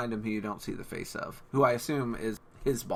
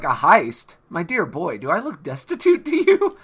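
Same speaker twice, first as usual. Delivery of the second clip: haughty, louder scoff; 2 semitones higher, 7 dB louder than usual. Retold or imitated. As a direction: scoff like a snobbish aristocrat. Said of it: "he's like".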